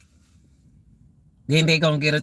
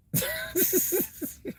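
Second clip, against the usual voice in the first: soft breathy laugh; 12 semitones higher, 9 dB quieter than usual. Real, not acted. Laughing at own bad mouth.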